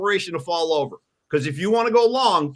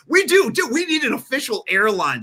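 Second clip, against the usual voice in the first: much higher and louder, excited; excited high burst; 7 semitones higher, 3 dB louder than usual. Real, not acted. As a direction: blurt it out thrilled. Excited sponsor pitch.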